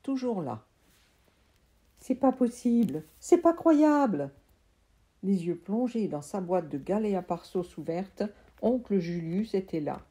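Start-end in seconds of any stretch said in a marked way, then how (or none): 2.0-4.3 amazed disbelief. Acted. Uncle stunned at the seeds.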